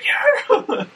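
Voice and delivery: high whooping yeah, laughing; excited cheer. Retold or imitated. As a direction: mimic a fired-up celebration. Imitating golfer's ace celebration.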